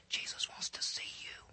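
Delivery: very soft, breath-only confidential aside; hushed message. Retold or imitated. Sister discreetly tells Mary the teacher wants her, hushed. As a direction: whisper it as a private message.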